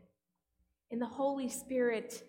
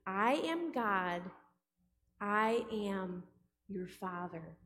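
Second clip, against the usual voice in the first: slow, low, Vader-like divine voice; voice of God; 4 semitones lower, slower than usual. Acted. God speaks to the baptized, slow solemn low voice. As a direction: intone it slowly, word by word. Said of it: "God speaks".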